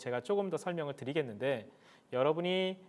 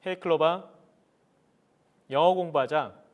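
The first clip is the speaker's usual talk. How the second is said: talking to voice assistant; 2 semitones higher, 7 dB louder than usual. Real, not acted. Commanding smart speaker.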